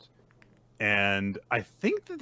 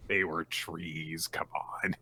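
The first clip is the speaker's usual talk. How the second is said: hushed excuse; 6 semitones lower, 10 dB quieter, slower than usual. Retold or imitated. Denji's muttered excuse.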